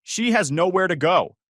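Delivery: loud high declaration; announcing. Retold or imitated. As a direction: announce it to the room. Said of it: "Brittany announced".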